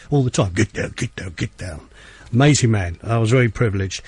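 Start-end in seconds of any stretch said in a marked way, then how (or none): 0.5-1.8 fading, lower mock [name] chant; lightly voiced [name] catchphrase; imitating [name]. DJ showing how [name] always talked, fading low mock chant. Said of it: "he talks like that all the time".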